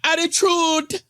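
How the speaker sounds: high mock-sassy Attitude rising at the end; light imitation of a sassy girl, Attitude lifting up; sassy girl mimic. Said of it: "Yes, sassy girl".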